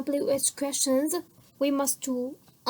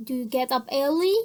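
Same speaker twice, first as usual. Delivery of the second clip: a quicker "Do you get up early?" that rises on "early"; brisk yes-no question, pitch up on "early"; fast question lifting at "early"; rising intonation; faster than usual. Shown as a demonstration. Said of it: "دەنگم بەرزتر و لاودرتر بێ".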